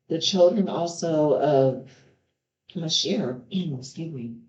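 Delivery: ordinary delivery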